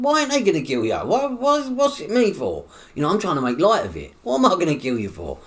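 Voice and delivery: high, loud acted disbelief; incredulous protest. Man at the bedside answering a murder accusation, high and incredulous.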